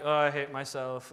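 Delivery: low, glum self-pitying moan; mock the miserable believer, voice sunk down.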